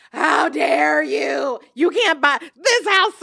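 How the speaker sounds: high, drawn-out mock outrage; stretched-out retold fit of indignation; losing her mind, imitated. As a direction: act out an outraged girlfriend, slow and high-pitched. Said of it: "she lost her mind on the video".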